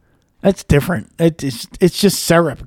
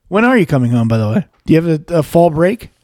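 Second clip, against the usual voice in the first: ordinary delivery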